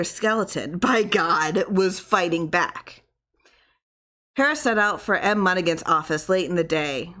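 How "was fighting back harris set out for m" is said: ordinary delivery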